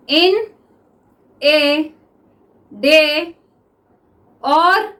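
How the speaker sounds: teacher dictating to the class; dictation reading. Shown as a demonstration. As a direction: dictate word by word for students to write. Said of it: "okay, तो start".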